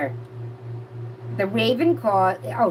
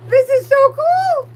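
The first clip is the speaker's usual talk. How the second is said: very high, loud, drawn-out squeal; really excited; 8 dB louder, slower than usual. Real, not acted. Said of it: "I get really excited".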